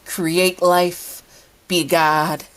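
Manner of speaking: high, pouty teasing voice. A narrator's reading. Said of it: "They pout at him".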